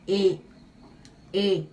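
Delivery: E closed like a circumflex ê, mouth drawn down. Shown as a demonstration. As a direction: say "E" closed, jaw dropping, never spread. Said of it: "como se tivesse um acento circunflexo".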